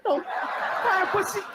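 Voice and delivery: high, fast jeering; mock teasing bully. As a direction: taunt the typing kid.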